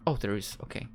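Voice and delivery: ordinary delivery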